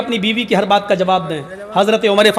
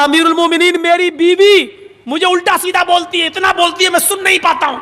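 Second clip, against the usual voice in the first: much higher, eager outpouring of grievance; excited complaint; 7 semitones higher than usual. Retold or imitated. Young man telling the caliph about his wife, high and eager. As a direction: blurt out the whole story at once.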